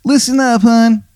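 high, hammy Baltimore "hun" voice; the worst Maryland accent you've ever heard; bad Maryland accent. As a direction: play a ghost with a terrible Maryland accent, way up high. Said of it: "he goes".

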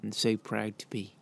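fighting tears while praising her; getting teary. Real, not acted. A husband honouring his wife Anna on their anniversary, getting teary. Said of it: "I'm getting all teary now".

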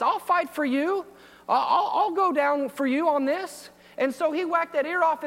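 preaching with rising fervour; fervent preaching. Acted. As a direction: drive it home from the pulpit. Preaching retelling Peter's zeal.

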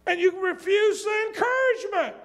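fiery preacher shout; preaching shout. Real, not acted. Preacher calling out the congregation.

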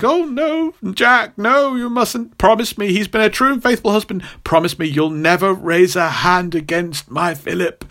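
very high, pleading falsetto mother; dying mother. Retold or imitated. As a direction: beg your son from the deathbed in a high voice. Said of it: "And she's like".

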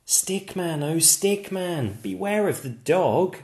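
high, sing-song warning call. Acted. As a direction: chant it like a friendly warning. Chanted warning refrain.

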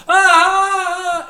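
long high loud wail; imitated kid's wail. Imitating the kid sitting in the audience, long loud wail. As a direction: hold out a loud, high mock cry.

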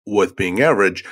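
normal talking voice

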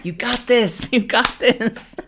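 cheering hype man, the second slowed right down. Pretend. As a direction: pump them up like a hype man. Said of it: "a hype man".

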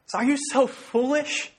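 high, slow plea; pleading. Retold or imitated. Paul pleading with the Galatians, high and slow. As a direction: beg them to see it. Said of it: "It's a plea with them".

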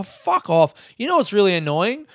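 usual speaking voice

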